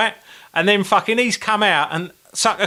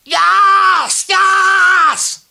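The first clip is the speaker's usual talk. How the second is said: high, loud victory shout; triumphant yell; 7 dB louder than usual. Retold or imitated. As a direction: cheer like winning the World Cup. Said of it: "like he'd fucking won the World Cup".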